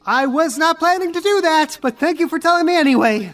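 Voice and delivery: put-on high girlish NPC voice, a little huffy; Angel's voice. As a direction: play the timid girl, pitched well up.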